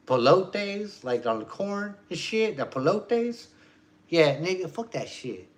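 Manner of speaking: hammed-up dismissive voice; imitating dismissive objector. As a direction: put on someone griping. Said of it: "they did it like this".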